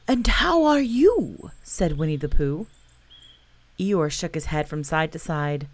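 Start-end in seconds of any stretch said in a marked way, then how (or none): 0.1-1.5 higher, louder, slow friendly bear voice; a kind little bear asking; cheerful bear. Acted. Pooh answers Eeyore.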